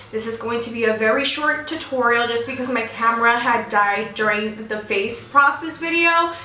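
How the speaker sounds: ordinary talk, nothing marked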